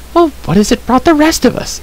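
put-on high-pitched challenge in a girl's voice; indignant girl. A narrator's reading. Girl objecting to Terran's grief, indignant. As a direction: lift the voice high to question him.

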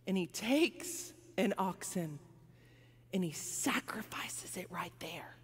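whispered solemn reveal. A narrator's reading. Preacher telling David's sacrifice, in a whisper. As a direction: drop to an awed whisper.

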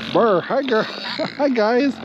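high sing-song voice for animals. Real, not acted. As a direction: coo at the piglets. Talking sweetly to the piglets.

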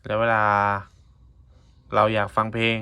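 usual speaking voice

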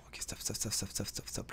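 breathless hushed stop; hushed urgent stops; urgent whisper. Real, not acted. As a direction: whisper it so the guard won't hear. Sneaking near an enemy camp.

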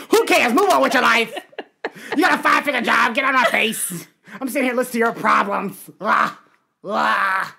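loud mock tirade; exasperated comic shouting; hammed-up annoyance and disgust. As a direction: play a fed-up therapist yelling at a client. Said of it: "with such annoyance and disgust".

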